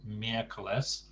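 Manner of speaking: miércoles with the stress on the first syllable MI. Shown as a demonstration. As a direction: lean on MI in miércoles. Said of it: "stress on the, the first syllable".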